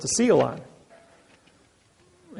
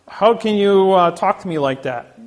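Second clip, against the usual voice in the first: higher, louder mock outrage; indignant retort; 5 semitones higher, 5 dB louder than usual. Attorney protests to the speaker, in mock indignant outrage. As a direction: play the offended attorney protesting.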